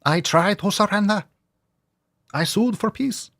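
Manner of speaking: a hint of a pleading pet; dog's voice. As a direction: speak for the dog, mock wounded. Voicing the dog.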